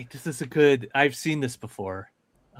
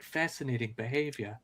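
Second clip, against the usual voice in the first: Jane Goodall nature narrator; 10 dB quieter than usual. Retold or imitated. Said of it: "like the Jane Goodall meme".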